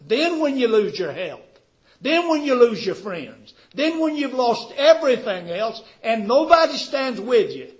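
loud, high, driving preacher's voice; impassioned preaching. Real, not acted. Preacher listing losses to the congregation, loud impassioned preaching. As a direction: pile up each loss with mounting force.

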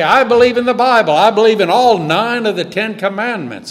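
loud, fast boastful claim; smug believer. Retold or imitated. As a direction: brag it proudly. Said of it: "are the sort that will stand and say".

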